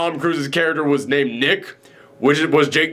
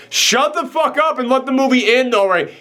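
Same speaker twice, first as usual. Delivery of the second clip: high, fed-up acted outburst; exasperated; 9 semitones higher than usual. Viewer begging an annoying character to stop, exasperated. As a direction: snap back at the pest, pitched high.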